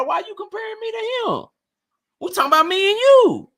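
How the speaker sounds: Drico's voice jumps up in protest; indignant Drico. Retold or imitated. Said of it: "And Drico like".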